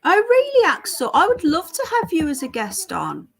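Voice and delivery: high, loud burst of delight; thrilled to be taken up on it; delighted surprise. Real, not acted. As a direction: squeal the opening, then gush on eagerly. Delighted at a viewer's offer.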